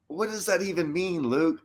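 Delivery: mock-frustrated question to Luke; baffled exasperation. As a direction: throw up your hands at his advice. Baffled by advice.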